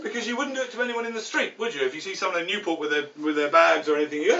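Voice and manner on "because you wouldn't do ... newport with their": normal talking voice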